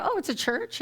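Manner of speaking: high, rising astonished question; mock surprise. Acting out own surprised question.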